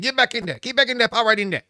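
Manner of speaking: high, loud shouting at the pot; scolding shout. Retold or imitated. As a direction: yell it like you're bossing the pot around. Cajun relatives scold a pot.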